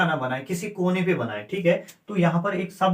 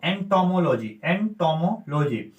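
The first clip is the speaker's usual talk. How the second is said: Entomology को धीरे-धीरे, हर अक्षर अलग बोलें; शब्द को धीरे से समझाते हुए उच्चारित करें; धीमा, साफ़ उच्चारण; slower than usual. Shown as a demonstration. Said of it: "और देखो". (एंटोमोलॉजी धीमे, स्पष्ट स्वर में)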